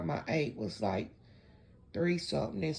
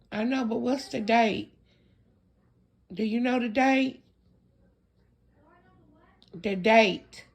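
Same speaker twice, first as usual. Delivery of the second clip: raised voice, asking someone across the room; calling out; 7 semitones higher, 6 dB louder than usual. Real, not acted. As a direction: call out to someone in another room.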